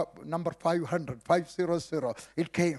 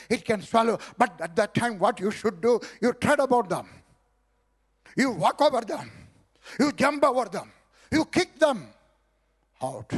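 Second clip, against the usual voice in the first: rising from firm to a loud, high shout; preacher to congregation, building to a loud high shout; building preaching shout; 4 semitones higher, 5 dB louder than usual. Real, not acted. Preacher urging the congregation to kick the snakes out, rising to a loud cry.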